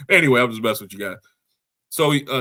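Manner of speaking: usual speaking voice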